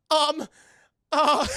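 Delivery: loud, high, flustered hesitations; hammed-up awkward stammer of a sweaty suitor; nervous Goliath. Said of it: "he's just so nervous and sweaty".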